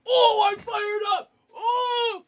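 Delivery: high, loud, drawn-out holler; pumped-up high-pitched shouting; fired-up shout. Real, not acted. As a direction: yell it out, all worked up.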